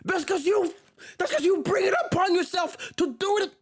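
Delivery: high, incredulous comeback; exasperated retort. Real, not acted. Retorting to the quote.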